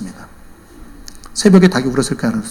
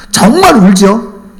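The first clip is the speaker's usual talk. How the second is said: louder, higher, drawn-out double-checking; insistent questioning; 6 semitones higher, 9 dB louder, slower than usual. Retold or imitated. Buyer double-checks with the chicken seller, insistent. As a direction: press for a sure answer, stretching it out. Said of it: "또 묻습니다".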